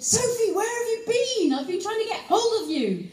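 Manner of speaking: high, loud mock scolding; cross agent. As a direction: imitate an exasperated agent telling off a client. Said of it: "she was a bit cross".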